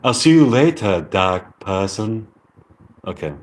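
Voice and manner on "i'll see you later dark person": British accent, slowing at the end; amateur British accent with dropped Rs. Shown as a demonstration. Practising a goodbye from the lesson, British accent. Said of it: "British accent lesson".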